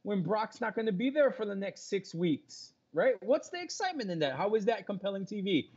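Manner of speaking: high-pitched, exasperated disbelief; incredulous challenge. Real, not acted. Heated argument challenging co-host's idea.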